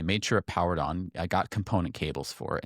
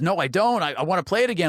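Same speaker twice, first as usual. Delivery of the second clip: high, louder imitation of an eager friend; 11 semitones higher, 6 dB louder than usual. Friend offered his favorite game, eager. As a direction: act out a friend keen to play, pitched way up.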